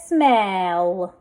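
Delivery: smell drawn out, each sound held; blended slowly. Shown as a demonstration. Teacher sounding out a word for readers, blended slowly. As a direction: stretch smell through to the l. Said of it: "Let's read this".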